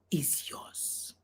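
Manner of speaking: soft, low whispered ending; hushed warning. Real, not acted. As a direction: drop away into a whisper.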